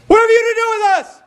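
high, loud shrieking demon cry; high piercing demonic scream. Retold or imitated. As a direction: shriek it out like a possessed man. Demon screaming at Jesus in synagogue.

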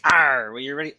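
gruff Arr pirate dwarf. Acted. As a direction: growl it like a pirate. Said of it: "Oh, no, it's a pirate".

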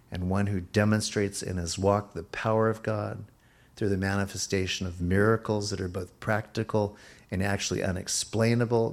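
ordinary delivery

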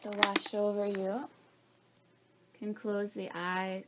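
soft, hushed meditation voice; guided meditation. Acted. Opening meditation before the practice.

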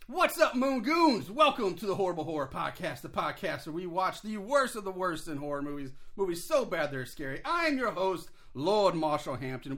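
hammed-up podcast host welcome; show host intro. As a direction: open the show like a ringmaster. Host's big show opening.

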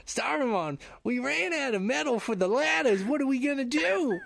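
high-pitched Boston goblin; Boston accent. Acted. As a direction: whine to the boss in broad mock Boston. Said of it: "why is he from Boston?".

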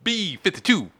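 calling out a bingo number; bingo caller. Shown as a demonstration. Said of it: "more like a bingo caller".

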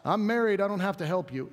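lightly voiced smug married excuse, dropping away; hammed-up self-excusing shrug; mock excuse. Preacher mocking married people dodging service, smug. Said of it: "that doesn't mean we're off the hook".

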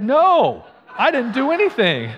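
loud, high-pitched protest; hammed-up yell of mock innocence; shouted denial. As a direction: deny it at the top of your voice. Retold heated denial to dad.

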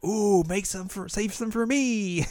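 mock sweet begging for cake; whiny Yui. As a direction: stretch it out like a greedy little sister. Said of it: "And Yui's like".

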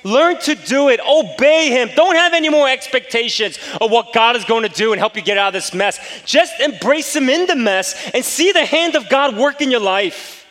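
loud, high, impassioned pulpit exhortation; preaching at full force. Acted. Pastor urging the congregation to trust God, full-force preaching.

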